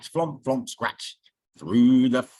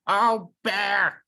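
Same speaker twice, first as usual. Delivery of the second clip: worried cry; 7 semitones higher, 4 dB louder than usual. Acted. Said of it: "cried Chook".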